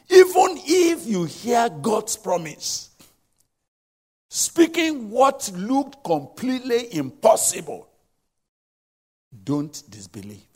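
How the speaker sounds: loud, slow exhortation; preaching. Real, not acted. Preacher exhorting the congregation loudly and slowly. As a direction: drive it home to the congregation.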